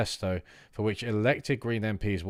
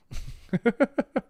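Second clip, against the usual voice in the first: much higher squealing laugh; 14 semitones higher than usual. Real, not acted. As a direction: burst into a high giggle. Laughing at own bad accent.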